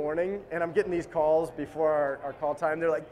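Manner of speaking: ordinary delivery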